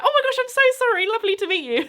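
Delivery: very high, rapid imitation of an apology; flustered fan. Embarrassed fan apologising before rushing off, flustered and excited. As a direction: gush it in a rush, mimicking an excited stranger.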